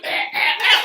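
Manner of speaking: very loud, high strangled gagging; mimed gagging in loud bursts; imitated choking. Storyteller choking on the floor, loud gagging.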